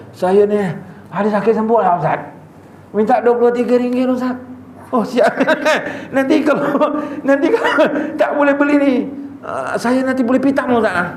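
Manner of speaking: wheedling beggar. Retold or imitated. Beggar on a motorbike greets the ustaz, high wheedling mock voice. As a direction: sweet-talk the ustaz with a laugh.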